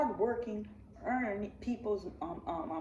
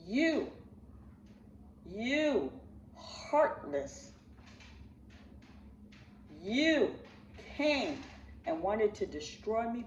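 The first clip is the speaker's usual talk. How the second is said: accusing; much slower than usual. Real, not acted. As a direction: hurl each word at him. Confronting Umar directly.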